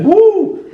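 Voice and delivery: high, loud "woo"; excited whoop. Real, not acted. Whooping to mates over the chrome paint plan, excited. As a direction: let out a sharp cheer.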